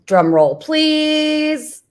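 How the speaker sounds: drawn-out showy reveal, rising high; playful game-show build-up; mock announcer. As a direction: build suspense like an emcee.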